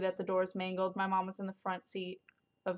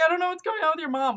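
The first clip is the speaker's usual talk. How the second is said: panicked stepmom; 9 semitones higher, 9 dB louder, much faster than usual. Retold or imitated. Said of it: "called me first and was like".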